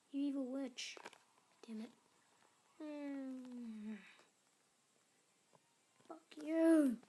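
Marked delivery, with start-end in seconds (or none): 6.1-7.0 loud, higher curse at the game; angry shout. Real, not acted. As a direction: blurt it out furious at losing. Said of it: "I get really mad when I'm losing".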